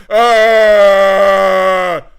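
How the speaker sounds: loud alarmed yells of stranger. Shown as a demonstration. Showing what kidnapped kids should yell, loud alarmed shout. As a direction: yell for help like a kidnapped kid. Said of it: "you're supposed to go".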